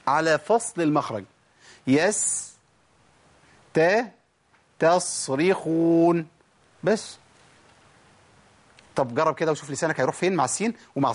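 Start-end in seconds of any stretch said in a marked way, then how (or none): 4.8-6.3 very slow تصريخون with the ت clearly separating the sounds; slow syllables. Shown as a demonstration. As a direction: isolate the ت in تصريخون. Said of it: "التاء اللي في النص".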